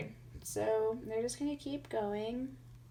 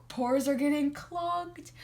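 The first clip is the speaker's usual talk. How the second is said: disgusted; 5 semitones higher, 6 dB louder, slower than usual. Real, not acted. Disgusted complaint about makeup.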